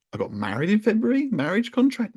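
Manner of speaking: high, questioning disbelief; light baffled rising question; mock puzzled guess. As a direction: toss it up like a wild guess. Incredulous retold guess, very high.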